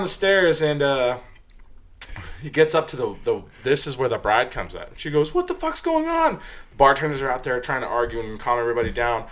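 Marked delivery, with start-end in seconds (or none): 5.3-6.3 high mimicked demand; high-pitched mock outrage; outraged. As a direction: imitate an incensed bride. Bride confronting the brawl.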